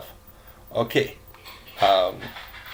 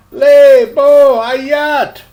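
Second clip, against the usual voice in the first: high, loud, very slow blurt; scared boy blurts a zoo greeting; 13 semitones higher, 13 dB louder, much slower than usual. Acted. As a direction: burst it out in panic, way up high. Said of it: "blurted out".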